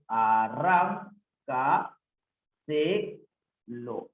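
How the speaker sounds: "Arranca" very slow, a-rran-ca with gaps; syllable separation. Shown as a demonstration. Teacher showing separación silábica, "Arranca" in slow separate syllables. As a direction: break "Arranca" into held syllables. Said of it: "separación silábica".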